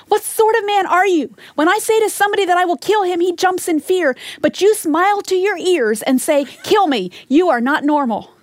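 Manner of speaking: hammed-up outrage at a prisoner who won't fear death; furious outburst. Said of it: "He exploded in fury".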